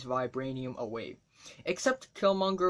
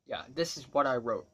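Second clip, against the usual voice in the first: ordinary talk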